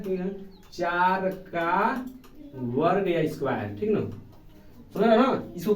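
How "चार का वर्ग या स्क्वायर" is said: चार का वर्ग said very slowly, word by word; slow reading demo. Shown as a demonstration. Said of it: "पढ़ते कैसे हैं?". (धीरे-धीरे पढ़कर दिखाना)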